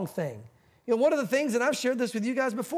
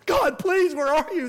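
mock desperate plea; 5 semitones higher, 6 dB louder, slower than usual. Preacher's mock plea while teaching.

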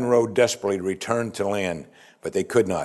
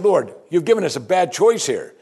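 higher-pitched acted appeal; pleading sailors; 7 semitones higher than usual. Sailors beg God not to make them drown Jonah, higher pleading. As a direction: plead the dilemma upward to God. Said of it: "And they said".

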